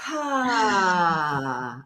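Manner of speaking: long audible sigh. Shown as a demonstration. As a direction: let the breath go in a big sigh. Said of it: "just sigh it out".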